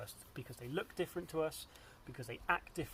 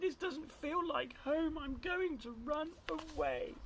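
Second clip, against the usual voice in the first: high, slow storybook wail; plaintive cry; 11 semitones higher, slower than usual. A narrator's reading. Drip decides to leave home, high drawn-out cry. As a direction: draw out Drip's high lament. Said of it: "And he cried".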